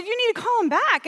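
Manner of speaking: high, loud retold urging; urgent insistence. Retold urging, repeated to mother.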